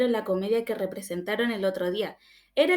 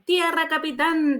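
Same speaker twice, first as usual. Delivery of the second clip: high, slow call of land; shouted call; 6 semitones higher, 4 dB louder, slower than usual. Acted. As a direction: call it across the deck, drawn out. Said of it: "dijo Gómez".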